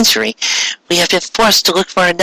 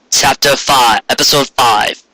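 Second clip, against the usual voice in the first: plain reading voice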